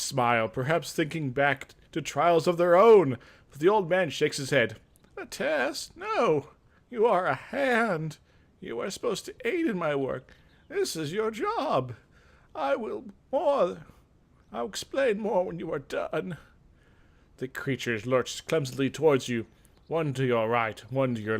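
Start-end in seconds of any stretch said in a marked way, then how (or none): 5.2-16.4 put-on old mage voice. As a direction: correct the newcomer like a stern master. Old mage explains the job.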